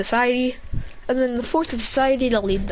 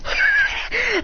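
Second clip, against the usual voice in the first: high acted laugh; mocking laugh; 13 semitones higher than usual. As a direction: laugh high at foolish enemies.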